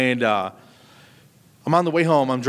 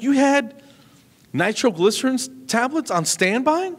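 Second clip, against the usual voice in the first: high-pitched, slow incredulous squeak; shocked disbelief; 12 semitones higher than usual. Retold or imitated. Retold shocked question to wife.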